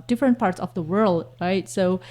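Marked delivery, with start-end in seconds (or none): none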